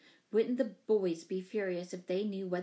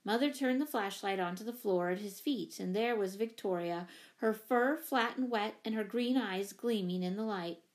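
ordinary delivery, nothing marked

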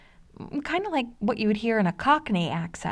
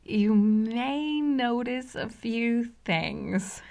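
slow, drawn-out vampire delivery; Dracula voice; 3 semitones higher, much slower than usual. Acted. Narrator playfully imitating the original Dracula, slow vampire voice. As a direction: draw it out like a theatrical Dracula.

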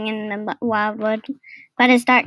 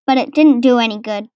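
ordinary delivery, nothing marked

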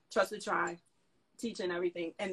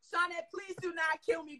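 usual speaking voice

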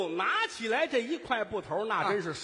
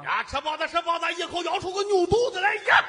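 high, loud street hawker's yell; frightening vendor cry; 9 semitones higher, 5 dB louder than usual. Retold or imitated. Bun seller at Jinan station, frightening hawking cry. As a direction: holler it to scare the kids. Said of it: "一吆喝能把小孩吓哭了".

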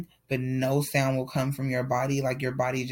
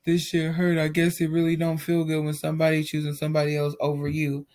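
put-on self-pitying boyfriend; 2 semitones higher than usual. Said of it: "he comes to me like".

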